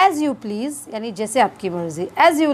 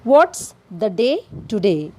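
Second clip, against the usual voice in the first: slow teaching demo; much slower than usual. Shown as a demonstration. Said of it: "इसे कहेंगे".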